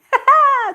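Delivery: loud high burst of laughter; real high-pitched cackling laugh. Real, not acted. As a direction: crack up suddenly, loud and high. Cracks up at her own line.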